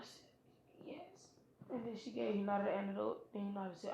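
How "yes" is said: faint, high whispered yes; weak whisper. Acted. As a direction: breathe it out, barely able to speak. Poisoned Hinata gives up.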